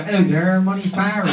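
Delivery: broad Dorset chat-up voice; Dorset accent. Acted. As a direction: introduce yourself in thick rural Dorset. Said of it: "original accent is a Dorset accent".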